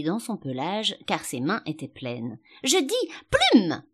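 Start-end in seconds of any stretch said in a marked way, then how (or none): 2.6-3.8 high, loud, drawn-out insistence; insistent cry. A narrator's reading. The sheep repeats her command to Alice, loudly insistent. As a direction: stretch it out loudly to be obeyed.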